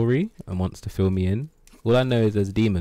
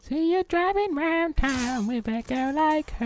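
very high falsetto voice; improvised falsetto lyric; falsetto quote. Pretend. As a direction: sing it up in falsetto. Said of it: "and I'm like".

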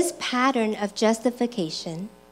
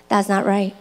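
lower, softer unmoved agreement; imitating an unfazed husband; deadpan; 5 semitones lower, 4 dB quieter than usual. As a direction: respond to a rant with even-keeled understatement. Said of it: "his expression is kinda like default".

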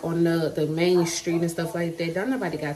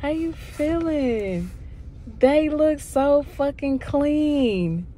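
excited; 9 semitones higher, 3 dB louder, much slower than usual. Real, not acted. Excited greeting after surgery.

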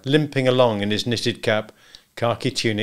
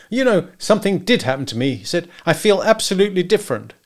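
high, excited client; Macumber, elated; 9 semitones higher, 4 dB louder than usual. Acted. Husband tells hunter he feels transformed, elated. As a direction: marvel at the change in yourself.

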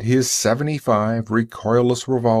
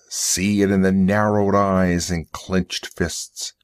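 ordinary delivery, nothing marked

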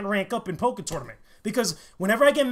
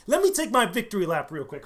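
ordinary delivery

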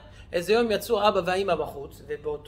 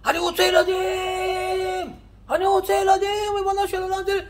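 desperate scream; 12 semitones higher, 6 dB louder than usual. Retold or imitated. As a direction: scream it to heaven, begging for children. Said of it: "הוא צרח". (צעקה נואשת, גבוהה ורמה)

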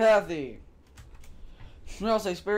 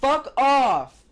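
angry; 4 semitones higher, 6 dB louder than usual. Acted. Angry outburst in game.

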